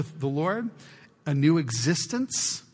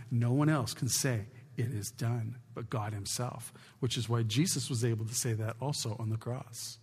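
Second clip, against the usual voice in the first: much softer, almost confiding; hushed reverence; 5 semitones lower, 9 dB quieter than usual. Real, not acted. Preacher confiding to his church about Jesus, softly hushed. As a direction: draw them in with a hush. Preacher drops to near hush.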